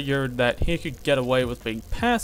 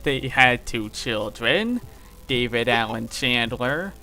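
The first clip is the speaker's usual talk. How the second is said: [name]. Acted. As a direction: stretch words and swoop up at the end. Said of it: "in the [name] voice".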